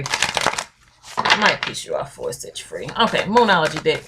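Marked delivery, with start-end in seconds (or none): 0.0-2.8 low slow repeat. Shown as a demonstration. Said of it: "The truth might piss you off".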